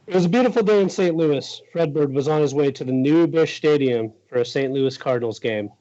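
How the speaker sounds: put-on story time for young listeners, pitch starting high and easing down; membaca buku anak. Reads picture book aloud.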